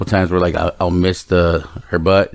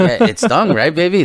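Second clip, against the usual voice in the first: teasing; 9 semitones higher than usual. Retold or imitated. Teasing daughter.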